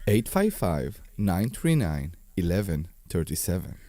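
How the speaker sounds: deep, low phone number read; smooth low announcer voice; sexy voice. Acted. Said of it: "the sexy voice man".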